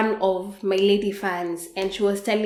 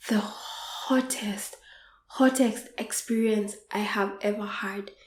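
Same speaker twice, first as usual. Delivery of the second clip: hushed whisper; 7 dB quieter than usual. Real, not acted. Whispering to viewers about her hottest night. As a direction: breathe it out like a secret.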